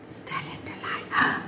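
whispered and frozen still; hushed awe. Acted. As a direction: freeze and whisper it. Acting the startled, frozen animal.

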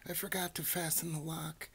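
high-pitched, fretful mouse voice; panicked mouse. A narrator's reading. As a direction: fret in a high little voice.

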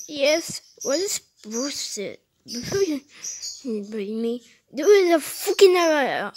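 usual speaking voice

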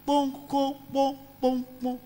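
high, rhythmic knocking noise; high-pitched mimicked banging; imitated door knock.

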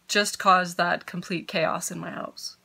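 ordinary talk

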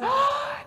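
sharp high excited gasp. A narrator's reading. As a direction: catch the breath in delight. Girls spying on a man.

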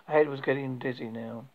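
ordinary delivery

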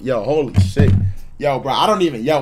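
ordinary delivery

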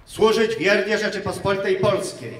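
loud, measured oath recitation; solemn oath. Real, not acted. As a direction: proclaim each line for the ranks to repeat. Officer leads oath, recruits repeat. (uroczyste, głośne odczytywanie roty)

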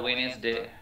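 "ওয়েডনেসডে" said as wenz-day, first d dropped; "ওয়েডনেসডে" with a mute d; silent D. Shown as a demonstration. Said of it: "not ওয়েডনেসডে".

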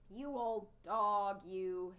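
put-on boy scolding his dog crossly. A narrator's reading. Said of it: "Henry said crossly to Ribsy".

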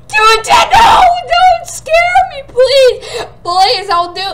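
high shouted begging; frightened high shouted plea; scared pleading. Real, not acted. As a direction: beg loud and high, frightened.

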